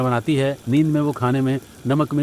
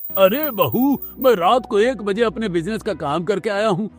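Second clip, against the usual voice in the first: irritated father-in-law; 7 semitones higher than usual. Acted. Father-in-law woken by bell.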